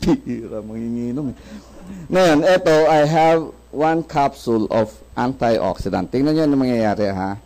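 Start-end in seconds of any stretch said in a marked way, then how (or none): none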